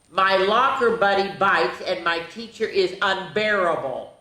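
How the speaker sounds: animated picture-book narration; reading to children. A narrator's reading. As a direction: read the diary like a bedtime story. Reads diary entries aloud.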